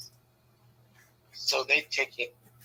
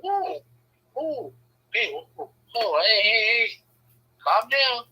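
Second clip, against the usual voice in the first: mock calming; 7 semitones higher, much slower than usual. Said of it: "I'm like".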